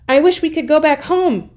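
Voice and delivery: high, loud urgent shout; shouted wish. Acted. Jack shouts his wish at the picture. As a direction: call the wish out hard. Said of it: "he shouted".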